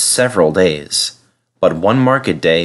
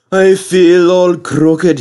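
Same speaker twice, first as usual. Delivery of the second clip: higher, louder, slow complaint; complaining; 9 semitones higher, 6 dB louder, slower than usual. A narrator's reading. Skarloey with a broken spring, complaining. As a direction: moan about the broken spring. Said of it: "he complained".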